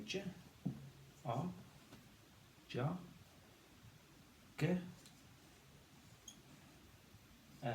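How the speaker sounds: J, O, blended to jo, then G, E as separate sounds; sounded out. Shown as a demonstration. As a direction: say J, O, jo, G, E as phonics sounds with pauses. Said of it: "And we say".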